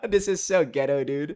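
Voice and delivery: laughing it out loud at himself. Real, not acted. Laughing at his own makeshift monitor setup to the viewers, laughing.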